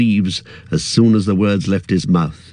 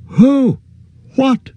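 high, loud alarmed shout; 4 dB louder than usual. A narrator's reading. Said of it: "shouted Frodo".

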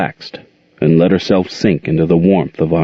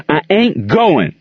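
yelled; 9 semitones higher, slower than usual. A narrator's reading. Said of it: "he yelled at Queen".

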